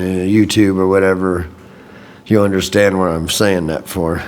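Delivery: ordinary talk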